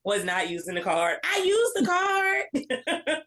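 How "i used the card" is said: high, drawn-out mock insistence; hammed-up protest. Kid insisting on a lie to parents, hammed-up protest. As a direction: stretch it out slowly in a high, fake-innocent whine. Repeats the childhood lie, exaggerated.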